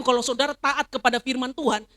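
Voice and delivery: usual speaking voice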